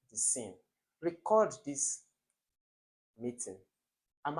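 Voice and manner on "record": "record" stressed on the second syllable, re-CORD. Shown as a demonstration. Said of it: "I have verb here".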